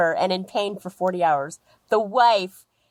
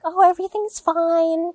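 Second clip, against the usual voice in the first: much higher, sugary people-pleaser voice; people-pleaser wife; 10 semitones higher than usual. Retold or imitated. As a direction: brush it off sweetly like nothing's wrong. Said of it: "the wife was a people pleaser".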